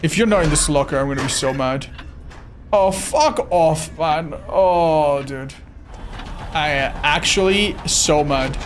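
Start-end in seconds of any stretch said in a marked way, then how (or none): none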